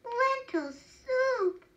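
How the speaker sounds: high, drawn-out exclamation of disbelief; dismayed cry. A narrator's reading. Woodcutter reacts to his supper, dismayed cry. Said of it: "cried the woodcutter".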